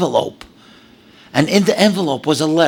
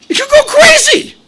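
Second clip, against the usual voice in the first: laughing shout, much higher and louder; excited shout; 8 dB louder than usual. Real, not acted. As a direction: burst out in amazed delight. Marvelling at the kindness.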